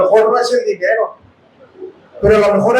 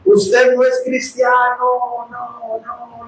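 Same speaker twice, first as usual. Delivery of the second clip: echoing voice; much slower than usual. Retold or imitated. Preacher re-enacting the voice he heard while driving, slow fading echo. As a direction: imitate an echo ringing in the head. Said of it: "yo escuché esa voz así, hermanos".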